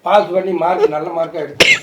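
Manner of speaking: low, soft, subdued voice; gentle reassurance. Acted. Uncle telling child to study well, soft and reassuring. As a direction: soothe the child quietly.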